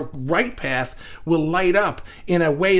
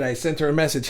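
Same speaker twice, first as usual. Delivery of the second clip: ordinary talk, nothing marked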